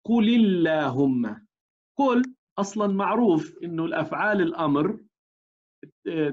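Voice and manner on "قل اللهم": "قل اللهم" read slowly as "قُلِ اللهم", the lam given a kasra; "قل" linked to "اللهم" through a kasra; slow recitation. Shown as a demonstration. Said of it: "مثال".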